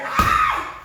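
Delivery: loud, very high-pitched scream. Retold or imitated. Driver slamming on the brakes in the RV, mock scream. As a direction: scream it out short and piercing. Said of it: "and I screamed".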